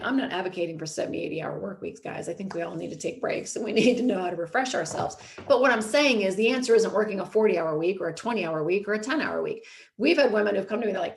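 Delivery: ordinary delivery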